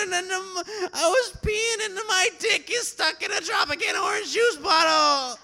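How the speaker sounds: frantic high-pitched shouted confession, near tears; panicked confession to the cop, high wailing shout. Retold or imitated.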